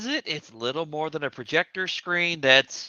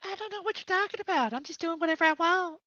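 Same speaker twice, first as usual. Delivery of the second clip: pitched-up voice changer; squeaky high voice-changed; chipmunk voice; 4 dB quieter than usual. Acted. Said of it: "make your voice higher".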